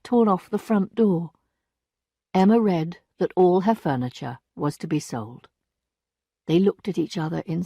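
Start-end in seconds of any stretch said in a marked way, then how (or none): none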